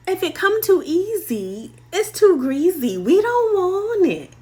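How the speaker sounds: exaggerated sassy high-pitched warning; playful rhyming slogan. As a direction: pitch it way up and belt out the rhyme. Playful rhyming catchphrase of advice.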